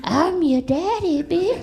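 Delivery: slow, swooping high voice; talking cigarette. Acted. Cigarette claims to be daddy, slow and cocky. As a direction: draw it out as a cocky little cigarette. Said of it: "the cigarette would be like".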